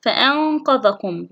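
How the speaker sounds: slow فأنقذكم with held nasal noon; ikhfa demonstration. Shown as a demonstration. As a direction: hum the noon before the ق of فأنقذكم. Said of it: "هنا نون أتى بعده قاف". (إخفاء النون بغنّة في فأنقذكم)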